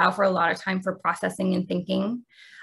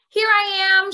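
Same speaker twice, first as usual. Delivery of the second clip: put-on much higher, loud child's shout; 12 semitones higher, 7 dB louder, slower than usual. A narrator's reading. Peter surprising his mother from hiding. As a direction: pop out and announce yourself. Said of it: "shouted Peter".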